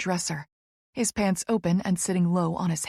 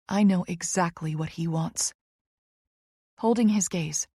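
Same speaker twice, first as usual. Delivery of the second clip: ordinary delivery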